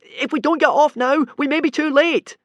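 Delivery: high, loud urgent call; urgent shout. A narrator's reading. Hiccup shouting to Fishlegs to abandon ship, urgent. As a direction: plead with him to leave now. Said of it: "shouted Hiccup, hesitating some more".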